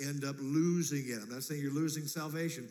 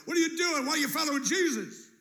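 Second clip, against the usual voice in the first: high, loud, incredulous challenge; incredulous family; 13 semitones higher, 6 dB louder than usual. Retold or imitated. Family demanding why you follow Jesus. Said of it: "relationships where they go".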